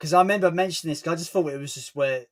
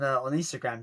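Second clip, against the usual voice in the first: normal talking voice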